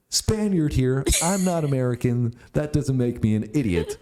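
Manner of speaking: high, mocking voice of the commenter; lightly hammed-up commenter voice. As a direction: sneer it out like a smug commenter. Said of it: "had to throw in".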